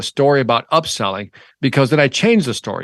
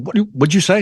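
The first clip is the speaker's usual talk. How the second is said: higher-pitched, taken-aback demand; incredulous challenge; 7 semitones higher, faster than usual. Acted. Sales trainer challenged by a kid upseller, incredulous. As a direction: challenge it in rising disbelief. Acts drama of being challenged.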